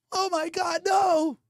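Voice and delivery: ordinary talk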